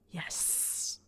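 soft, encouraging hiss; drawn-out sibilant whisper; Snape. A narrator's reading. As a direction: hiss it out to urge him on. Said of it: "Snape hissed encouragingly".

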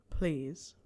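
low, soft, gentle please. A narrator's reading. Snape softly begging Harry to look at him, gentle plea. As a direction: ask gently, almost pleading. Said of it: "The request was soft".

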